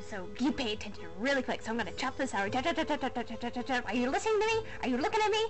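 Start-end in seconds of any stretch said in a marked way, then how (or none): none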